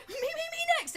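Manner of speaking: high squeaky begging for a turn; eager clamouring. Acted. Game master mimics players begging to be next, eager. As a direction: pipe up high, desperate to be picked.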